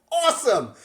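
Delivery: high, loud burst of praise; big pumped-up awesome; excited awesome. Retold or imitated. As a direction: celebrate it out loud. Coach reacting to good news.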